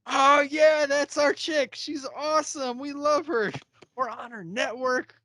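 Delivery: high, loud show-off brag; bragging flex. Retold or imitated. Podcasters bragging to rivals, high and loud. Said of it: "flex on them again".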